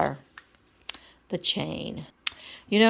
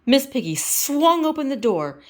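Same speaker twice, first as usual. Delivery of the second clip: ordinary delivery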